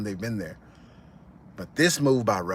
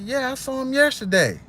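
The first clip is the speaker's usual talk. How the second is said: much higher, innocent reply; naive answer; 12 semitones higher than usual. Retold or imitated. The cousin answering the thug, high innocent voice. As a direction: answer unaware of danger. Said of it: "He said".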